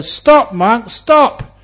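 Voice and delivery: high, loud cry of stop; shouted command. Retold or imitated. Angulimala shouting after the Buddha, loud. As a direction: bellow after the fleeing monk. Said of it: "shouted to the Buddha".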